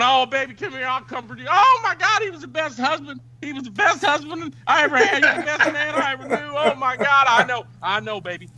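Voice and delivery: high hammed-up grieving voice; husband comforting the wife, high mock grief. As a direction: wail it like a soap opera. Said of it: "he's going".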